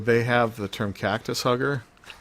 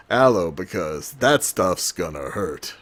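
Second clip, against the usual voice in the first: louder, slow hammed-up commercial line on "Aloe"; ad read; 5 dB louder, slower than usual. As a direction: pitch it like a cheesy sponsor. Mock sponsor ad read.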